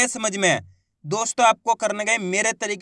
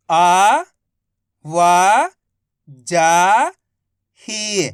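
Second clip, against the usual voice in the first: syllable-by-syllable reading demo; 4 dB louder, much slower than usual. Shown as a demonstration. Teacher showing students how to read सरकारी, slow and deliberate. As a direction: say each syllable apart, then stretch the whole word slowly. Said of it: "एक ही अक्षर का उच्चारण करके इनको पढ़ रहा हूँ".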